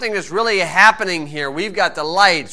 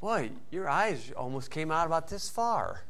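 mock admiring quiet remark; 2 semitones lower, 10 dB quieter than usual. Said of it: "you wanna say".

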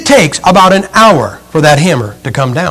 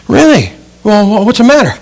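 higher, acted surprise and concern; high-pitched concern; 4 semitones higher than usual. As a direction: question the acorn with lifted pitch. Acted concerned question to the acorn.

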